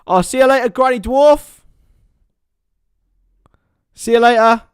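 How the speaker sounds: loud, bright see you later; hearty send-off; cheery farewell. Real, not acted. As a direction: call the goodbye out to the chat. Reading out and waving off chat.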